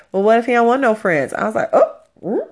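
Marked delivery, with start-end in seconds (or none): 0.1-1.3 higher, louder, quick mock retort; retold blunt challenge. Blunt old counselor challenges her worry. 1.7-2.5 high, loud mock surprise; retold taken-aback reaction.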